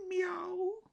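high squeaky meow; cat meow. Acted. Kitten at the window meowing high. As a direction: meow like a startled little cat.

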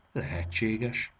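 slow, drawn-out musing. A narrator's reading. The watch muses over the fairy tale, slow and thoughtful. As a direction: ponder it aloud. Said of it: "mélázott Debóra".

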